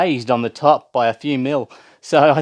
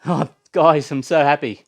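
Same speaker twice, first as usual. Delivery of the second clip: ordinary talk, nothing marked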